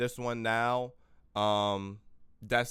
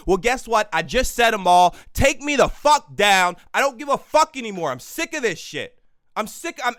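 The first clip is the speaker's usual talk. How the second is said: louder and higher, shouting it out at the censors; defiant yelling; 6 semitones higher, 6 dB louder than usual. Real, not acted. Vlogger yelling his defiance at Facebook and the billionaires. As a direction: yell straight into the mic, daring them. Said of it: "Maybe yelling at the microphone".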